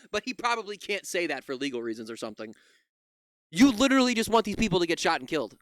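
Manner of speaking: ordinary talk, nothing marked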